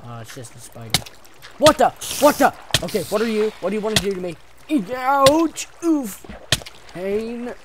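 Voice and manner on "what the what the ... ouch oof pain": loud, high startled cries; panicked yelps. Real, not acted. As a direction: yelp in pain and panic. Spider attacks him.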